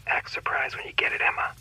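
conspiratorial whisper. Acted. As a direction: whisper it like a secret. Father whispers aside to daughter.

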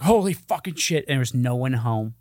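ordinary talk

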